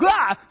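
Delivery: put-on loud high startled shout of little kids; kids' startled cry. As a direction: jump out a child's gasp-yell.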